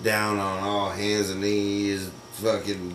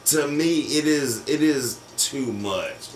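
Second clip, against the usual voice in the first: normal talking voice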